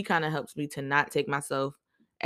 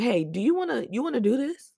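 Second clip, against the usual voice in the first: annoyed challenge; 9 semitones higher than usual. Retold or imitated. Confronting annoyed shift leader.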